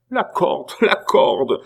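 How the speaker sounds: high repeated exclamation; mocking cry. A narrator's reading. Abbé taunting Pitou about hanging, crying out. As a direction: call it out on a high pitch.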